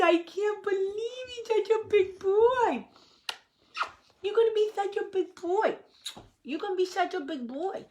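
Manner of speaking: high cooing voice to the puppy; talking sweetly to the puppy in a high voice. Real, not acted. Baby talk to the dog.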